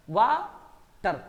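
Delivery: "वाटर" slow, वा, pause, टर; pause between syllables. Shown as a demonstration. Teacher showing the stop, "वाटर" as वा ... टर. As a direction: stop after वा in "वाटर", then say टर. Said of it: "रुक के फिर बोलना पड़ता है ना".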